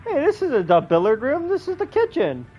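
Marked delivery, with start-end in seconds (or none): none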